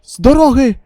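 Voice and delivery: loud, fierce yell to clear the way; loud shout. Acted. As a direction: bellow them aside. Charging, shouting. (гучний крик)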